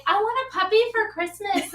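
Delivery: put-on high little-kid voice; pleading child. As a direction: imitate a kid wishing aloud, pitched high. Imitates child asking for puppy.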